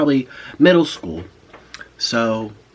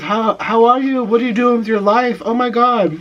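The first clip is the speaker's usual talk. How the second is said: a high, louder, slowed gush in a put-on voice; gushing teacher; 10 semitones higher, 5 dB louder than usual. Old teacher asking her former student about her life, gushing and high. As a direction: play the teacher overjoyed to see her old pupil.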